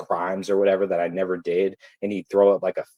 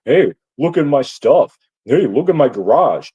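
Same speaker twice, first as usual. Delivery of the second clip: louder mocking brag; mock show-off; 4 semitones higher, 6 dB louder than usual. Bird showing off his tools, mocked as a loud brag. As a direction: boast like a guy showing off his stuff. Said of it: "on some materialistic shit".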